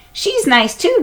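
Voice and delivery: put-on high, cheerful girl's voice; lightly voiced little sister; Annie's voice. A narrator's reading.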